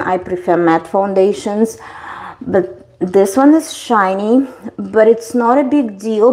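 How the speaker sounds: ordinary talk, nothing marked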